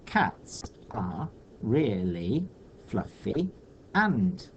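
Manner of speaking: "cats are really fluffy and" slow, in separated pieces; measured read-out. Shown as a demonstration. Teacher testing the shortened line, "cats are really fluffy and" slow and spaced out. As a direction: slowly test "cats are really fluffy and", pausing between words. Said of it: "That's two syllables".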